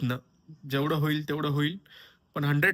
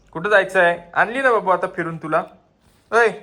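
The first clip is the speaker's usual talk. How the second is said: talking to a pet; 6 semitones higher, 10 dB louder than usual. Real, not acted. Pet talk to dog.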